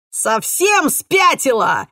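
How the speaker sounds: angry yell, slow and loud; shouting. A narrator's reading. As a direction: bellow it at her. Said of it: "орал Двейн".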